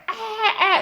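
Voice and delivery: high, short imitated screams; mock witch shrieks. Witches screaming as the demon dies, mock shrieks. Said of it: "they start hollering like".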